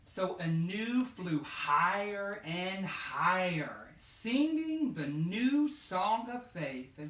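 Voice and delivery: slow, lifted read-aloud; storybook narration. A narrator's reading. Teacher reading the story's climax to children, slow and bright. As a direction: draw out the happy ending.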